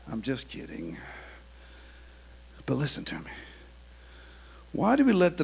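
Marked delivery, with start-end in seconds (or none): 0.1-3.3 hushed, intimate plea; whispered. Acted. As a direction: draw them in with a whisper. Preacher drops to whisper.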